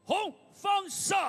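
drawn-out shouted verdict; winner announcement. Real, not acted. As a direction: call the winner loud and slow.

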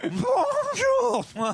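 very high cartoon horse; talking horse voice. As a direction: squeak it like a horse. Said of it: "J'essaie de faire un cheval qui parle".

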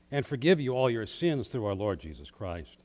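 ordinary delivery

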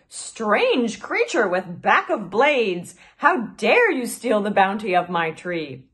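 loud, slow outraged squeaky voice; indignant sugar glider. Acted. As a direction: scold the thief from above. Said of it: "a voice screeched down from above".

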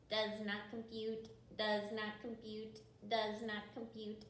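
neutral, monotone robot. Shown as a demonstration. Teacher shows kids how a robot talks, robot voice. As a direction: say each word evenly like a machine. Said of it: "do a robot voice like that".

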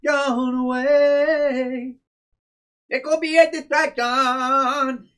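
slow, drawn out; hammed-up character voice. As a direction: stretch it out theatrically. Voicing character slowly.

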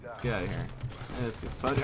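ordinary talk, nothing marked

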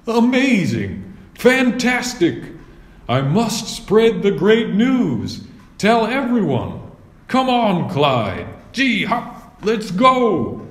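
put-on a young knight thrilled out of his wits; excited knight. A narrator's reading.

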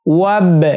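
ওয়াব with a bouncing echo on ব; ব-তে কলকলা; qalqala on ব. Shown as a demonstration. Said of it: "বাতে কলকলা হবে".

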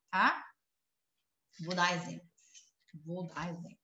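usual speaking voice

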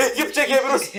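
high-pitched, laughing words. Real, not acted. Player as the whole team dies, laughing.

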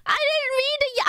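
usual speaking voice

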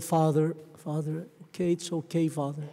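put-on hushed, gentle comfort for a crying old man; soft soothing. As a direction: soothe him softly, like calming a child.

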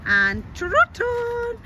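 very high, very slow "And trot on"; lilting, stretched rider's call; sing-song trot command. Real, not acted. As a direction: draw the call out long and high for the horse. Calling horse to trot.

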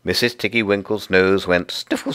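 ordinary delivery

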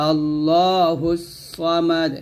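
"সামাদ" with a thick emphatic s, slowly drawn; heavy sad. Shown as a demonstration. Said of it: "সা বলবেন না". (মোটা সদ দিয়ে ধীরে "আল্লাহুস সামাদ")

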